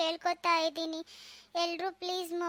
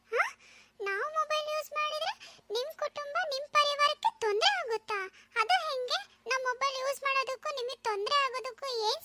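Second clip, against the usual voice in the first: put-on much higher, wondering kid voice; puzzled child; 7 semitones higher than usual. Boy asks the sparrow how phones hurt its family, puzzled childish voice. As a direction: ask it like a baffled little boy.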